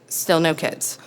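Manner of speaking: ordinary talk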